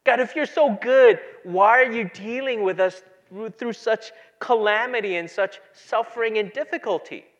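loud, high-pitched plea; crying out to God. Retold or imitated. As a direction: imitate a people calling out in complaint. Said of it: "they're crying out to God".

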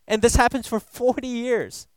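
ordinary talk, nothing marked

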